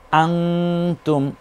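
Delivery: আংতুম with a long nasal hum on the ং before তুম; ikhfa. Shown as a demonstration. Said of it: "তখন ইকফা করে পড়তে হয়".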